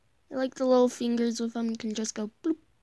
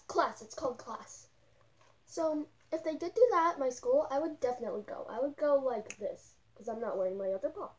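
muffled inside full mask; speaking behind furry mask; through a costume head; 2 semitones higher, 3 dB quieter than usual. Real, not acted.

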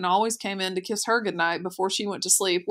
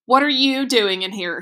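raised, louder indignant question; narrated shout; 4 semitones higher, 6 dB louder than usual. A narrator's reading. Sister confronting brother who barged in, mild shout. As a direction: raise the voice sharply, short of a full shout. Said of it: "she shouted".

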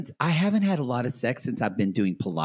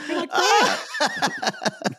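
laughing; much slower than usual. Real, not acted. Laughing imitation.

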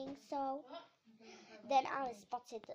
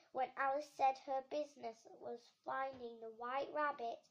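ordinary delivery

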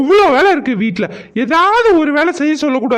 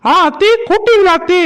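calling out; 6 semitones higher, 5 dB louder than usual. Acted. Daughter-in-law answers mother-in-law's call.

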